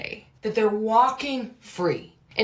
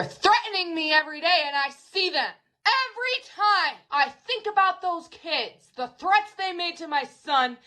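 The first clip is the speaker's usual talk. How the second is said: agitated; 9 semitones higher than usual. Real, not acted. Mom ranting about threats.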